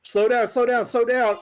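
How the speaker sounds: loud high mock yell of slow down; half-playful retold shout. Retelling brother yelling while carrying.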